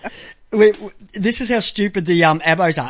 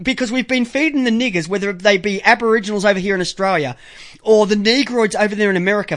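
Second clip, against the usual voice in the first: usual speaking voice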